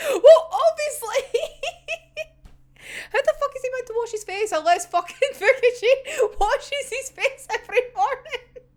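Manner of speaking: high, incredulous protest through giggles; laughing outburst. Real, not acted. Fan arguing about face washing, high and laughing. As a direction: pitch it up and laugh as you argue.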